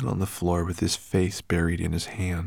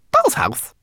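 high, loud indignant squeak; 8 dB louder than usual. A narrator's reading. Homunculus offered a girl's doll's house, indignant. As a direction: echo it back, affronted. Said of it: "looked at the girl indignantly".

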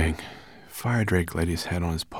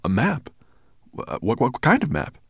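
higher-pitched, stammering eager question; excited; 7 semitones higher, 4 dB louder than usual. A narrator's reading. The dragon turning to Sorrel about the map, excited. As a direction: stumble over the words with excitement. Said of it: "Excited, the dragon turned".

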